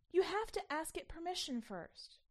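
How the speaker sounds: urgent insisting. A narrator's reading. Child insisting to Mommy.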